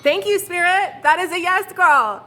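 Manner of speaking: high, loud cheering thanks; thrilled. Real, not acted. Reader thanking Spirit for a yes, loud and thrilled. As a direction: celebrate the sign.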